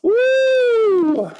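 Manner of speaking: high sustained woo; excited whoop. Real, not acted. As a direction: cheer like a fan. Cheering at gold envelope.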